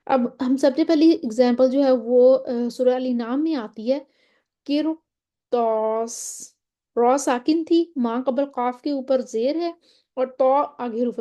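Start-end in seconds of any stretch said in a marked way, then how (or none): 4.6-6.4 قرطاس drawn out very slowly with a full, heavy ر; heavy ra recitation. Shown as a demonstration. Teacher recites قرطاس for students, slow with heavy ر. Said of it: "تو را کو ہم موٹا ہی پڑھیں گے".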